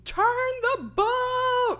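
high-pitched mock shout, stretched out; retold shouting. Water skier warning the boat drivers, high mock shout. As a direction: imitate a panicked yell without full volume. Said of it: "like, shouting".

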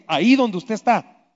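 ordinary talk, nothing marked

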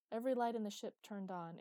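ordinary delivery

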